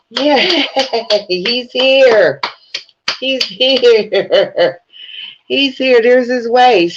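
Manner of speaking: high, laughing mock of gleeful inmates. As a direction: ham up inmates cheering a new arrival. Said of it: "They gonna be like".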